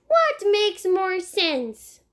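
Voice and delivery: put-on high little boy voice, slow; a small child explaining matter-of-factly. Fudge in the tree explaining to Sheila, in a high little boy voice.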